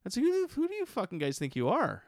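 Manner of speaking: indignant rant. Retold or imitated. Indignant rant at his friends.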